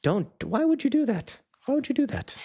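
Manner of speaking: very high-pitched dismayed pleading; mock scolding. Owner to his puppy after the gross story, mock scolding.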